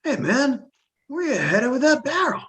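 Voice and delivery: high, casual guard calling out; elf guard voice. Acted. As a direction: call out as a nosy elf guard. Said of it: "kind of be like".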